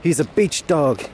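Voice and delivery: ordinary talk, nothing marked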